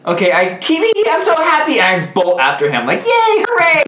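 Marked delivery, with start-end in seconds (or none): 0.6-1.8 high squealing glee; gleeful cheer. Acted. Character cheers chasing goblin. 3.0-3.8 very high squeal of joy; gleeful cheer. Acted. Character cheers chasing goblin.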